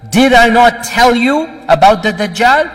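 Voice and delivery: high, loud emphatic rhetorical question. Retold or imitated. Prophet reminding his listeners about the Dajjal, loudly emphatic. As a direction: raise the voice and drive the question home. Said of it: "like this to emphasize".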